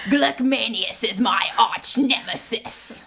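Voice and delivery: slow, theatrical villain voice; drawn-out, hammed-up villain proclamation; supervillain. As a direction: declare it like a comic-book supervillain. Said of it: "that is a supervillain name".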